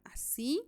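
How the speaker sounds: así with the final sí lifted; stress on last syllable. Shown as a demonstration. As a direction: raise the voice on the sí of así. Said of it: "llevan la sílaba tónica en la última".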